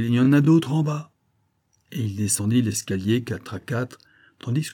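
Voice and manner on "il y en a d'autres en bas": raised, louder warning cry; avertissement crié. A narrator's reading. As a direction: shout a warning as you dash off. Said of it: "cria-t-il". (avertissement crié d'une voix forte)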